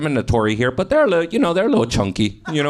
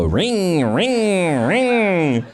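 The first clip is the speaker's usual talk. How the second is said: sing-song ring ring of a horn; mock horn ring; 4 semitones higher, much slower than usual. Comic imitating the sidecar horn for the audience, sing-song. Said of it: "it would go".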